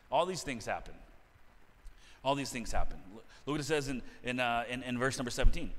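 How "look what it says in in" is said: normal talking voice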